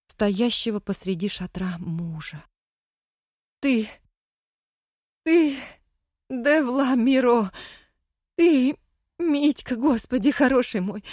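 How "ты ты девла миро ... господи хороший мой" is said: high, loud, sobbing with relief; cling to him, overjoyed; tearful joy. Acted.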